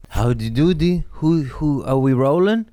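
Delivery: loud, high show-opening holler; calling out. Real, not acted. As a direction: holler to check the recording is on. Calling out as recording starts.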